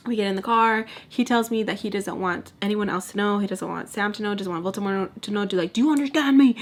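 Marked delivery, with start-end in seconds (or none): 5.7-6.6 higher, louder mock demand; getting crazy. Man demanding secrecy in the car, imitated heated demand. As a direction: imitate him laying down the law. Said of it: "getting crazy with me".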